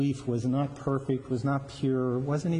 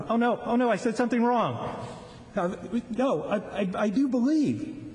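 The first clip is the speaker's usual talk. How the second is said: panicked father; 9 semitones higher than usual. Retold or imitated. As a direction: blurt it out in alarm, tripping over words. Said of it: "I can just imagine".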